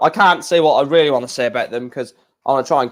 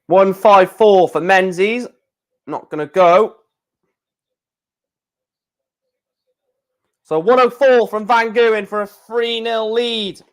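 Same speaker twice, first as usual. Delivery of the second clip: commentator voice; 6 semitones higher than usual. Acted. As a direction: call it like the TV commentator. Mock darts commentary.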